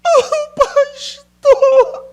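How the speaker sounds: high, drawn-out wailing sob; mock crying mother. Pastor imitating a mother crying to him. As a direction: wail it like a weeping mother. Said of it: "Ela chegou chorando pra mim".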